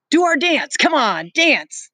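high, loud rallying cry; rallying shout. Acted. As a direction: whip the crowd up. Jack rallying the boys.

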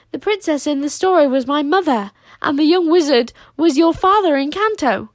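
put-on high, loud girl's voice revealing a secret; a young girl blurting out the truth; Wish's voice. A narrator's reading. Wish reveals shocking truth.

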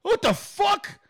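imitated outrage, high and drawn out; high-pitched hostile disbelief; aggressive reaction. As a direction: demand it slowly in mock fury. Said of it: "'cause she was so aggressive".

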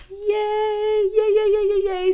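very high, squealing mock delight; giddy yay. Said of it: "So then he walks out and I'm like".

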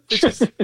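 high breaking laugh; real laughter. Real, not acted. Cracking up retelling the Brody skit, real laughing. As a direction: laugh helplessly at the memory. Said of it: "it's hilarious".

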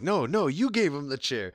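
high, loud protesting Eddie voice; imitating Eddie. Eddie denying to the referee, hammed-up high protest. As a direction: play innocent, pointing at the opponent. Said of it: "he's all like".

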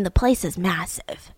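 dropped to a near whisper; whispered aside on the mall's size; hushed. Real, not acted. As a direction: breathe it like a secret. Stressing how huge the mall is.

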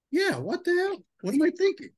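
high-pitched amazed self-talk; sudden realisation, voice jumping up; excited self-talk. Retold or imitated. Retelling his own realisation.

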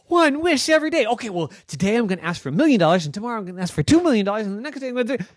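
put-on high, fast, greedy girl voice; excited girl Noah. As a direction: rattle off the wishes in a giddy rush. Noah greedy over wishes.

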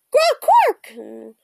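loud croaked bird noise; raven croak. A narrator's reading. Annie perched with Teddy on the ledge, raven croak. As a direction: caw it like a raven. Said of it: "she croaked".

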